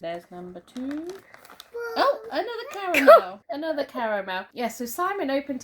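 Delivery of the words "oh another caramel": high, loud laughing exclamation; laughing surprise. Real, not acted. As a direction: burst out laughing at it.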